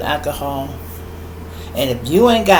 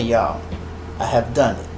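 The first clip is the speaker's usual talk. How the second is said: normal talking voice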